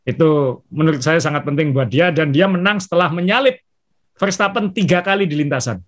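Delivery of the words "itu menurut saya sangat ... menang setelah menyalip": normal talking voice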